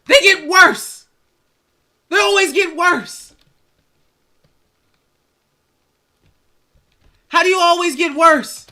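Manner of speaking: incredulous rant; exasperated. Real, not acted. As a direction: throw up your hands. Exasperated rant about the team.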